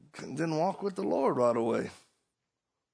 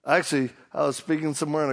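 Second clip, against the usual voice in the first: usual speaking voice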